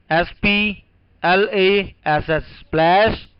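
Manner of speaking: letters spaced one by one; slow spelling out. Shown as a demonstration. Teacher spelling a word for students to copy, slowly. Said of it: "लिखिए".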